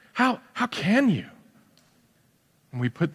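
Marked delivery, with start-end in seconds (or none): 0.1-1.3 high, slow, loud mock indignation; mock outrage. Preacher mocking a judgmental believer with loud outrage. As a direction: scold like a shocked churchgoer.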